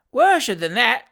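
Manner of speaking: put-on loud, high servant-boy roar. A narrator's reading. Fat boy roaring in the old lady's ear, loud. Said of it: "roared the fat boy".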